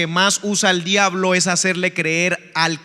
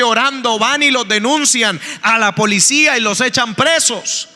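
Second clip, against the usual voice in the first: higher, louder preaching push; preaching at full force; 5 semitones higher, 3 dB louder than usual. Acted. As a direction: press the point hard to the congregation. Predica con fuerza. (predicación con fuerza, más alto y más fuerte)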